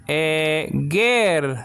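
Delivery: "egersis" very slowly, syllable by syllable; slow teaching pronunciation of "egersis"; drawn-out pronunciation. Shown as a demonstration. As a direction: stretch out each syllable of "egersis". Teacher sounds out the word.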